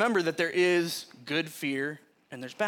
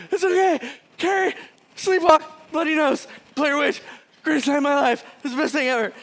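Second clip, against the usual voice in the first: much higher, louder words squeezed out through laughter; laughing hard; 10 semitones higher, 7 dB louder, faster than usual. Retold or imitated. Calming his panicked mom while helpless with laughter. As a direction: gasp it out between fits of laughter. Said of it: "through, like, my laughing".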